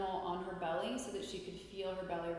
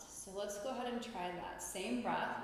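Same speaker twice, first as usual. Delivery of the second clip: ordinary talk, nothing marked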